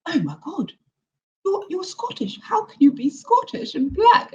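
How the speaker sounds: surprised English person. Retold or imitated. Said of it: "they're like".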